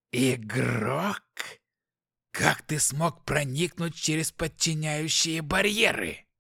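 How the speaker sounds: demon voice with a sly hiss; hissing, insinuating demon. Acted. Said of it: "шипящим, вкрадчивым голосом".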